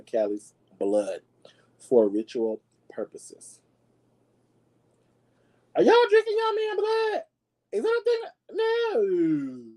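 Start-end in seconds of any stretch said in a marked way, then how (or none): 5.7-9.6 squeaky put-on high voice, much higher; mock shocked voice. Vlogger mocking the blood ritual in a squeaky voice. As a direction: play a scandalized squeaky character.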